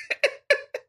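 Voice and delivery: airy high-pitched giggle. Real, not acted. Reviewer laughing at a crude lyric, high giggle. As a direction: giggle in disbelief.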